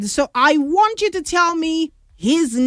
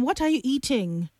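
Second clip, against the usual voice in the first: normal talking voice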